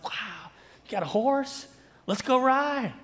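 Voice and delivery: hammed-up eager buddy at the barn; mock thrill over a horse; excited friend. Pastor mimics eager friend passing by.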